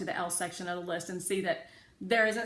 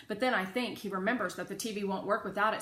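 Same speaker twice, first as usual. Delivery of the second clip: ordinary delivery, nothing marked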